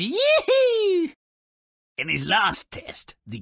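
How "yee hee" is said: gleeful squeal. Acted. Host squeals over puppies.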